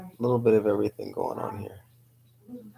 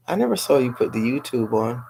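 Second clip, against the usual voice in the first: ordinary talk, nothing marked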